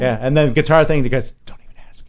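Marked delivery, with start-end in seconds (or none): 1.4-2.0 whispered dismissal. Retold or imitated. Bandmates dismissing him.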